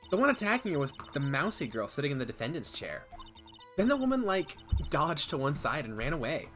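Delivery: put-on valley girl. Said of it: "Do a Valley Girl accent?".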